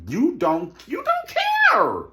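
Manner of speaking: very high, drawn-out loud cry; exasperated yell. Real, not acted. Host ranting that Robin neglects herself, exasperated high yell. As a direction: squeal it out slowly at full volume.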